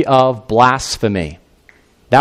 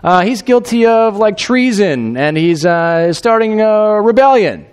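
accusers' voice; 7 semitones higher, slower than usual. Acted. Sanhedrin inventing treason charges for Pilate, high and halting. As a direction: fumble for a crime that will stick.

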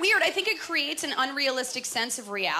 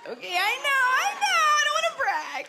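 very high, squealed humblebrag; high-pitched mock modesty; 13 semitones higher, 4 dB louder than usual. Comic bragging to the crowd about cable, mock-modest squeal. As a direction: put on a squeal of false modesty.

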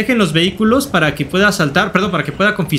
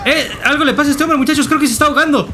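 raised-pitch alarmed call; mock alarm; 6 semitones higher than usual. Gamer telling the muchachos a man is choking, mock alarm. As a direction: call the others over, pitched up.

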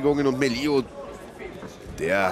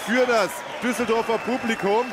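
higher, raised victory call; excited commentary; 5 semitones higher than usual. Real, not acted. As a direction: celebrate the title defence. Commentator calls title defence.

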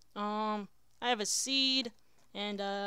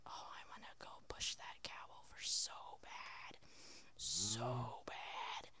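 very soft, breath-only whisper; conspiratorial whisper; 11 semitones lower, 14 dB quieter, slower than usual. Pretend. Player wanting to push the cow over, secretive whisper. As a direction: whisper a wicked craving, drawing out "so bad".

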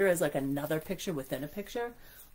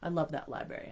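ordinary delivery

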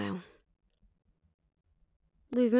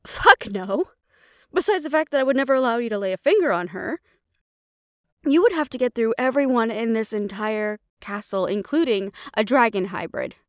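louder, fast and furious; angry refusal; 4 dB louder than usual. Acted. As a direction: snap back in defiance of mother. Daughter refuses to harm friend.

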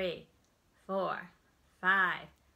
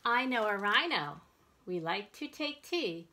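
put-on reading to children; 4 dB louder than usual. Reads second picture book aloud.

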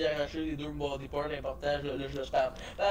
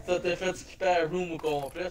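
laughing through the words; 4 semitones higher than usual. Real, not acted. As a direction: crack up while talking. Laughing at friend's mishap.

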